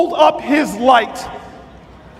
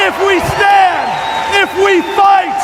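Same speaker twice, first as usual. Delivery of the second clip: preaching at full force; 7 semitones higher than usual. Acted. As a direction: rouse the crowd to stand up, line by line. Rally close to the crowd.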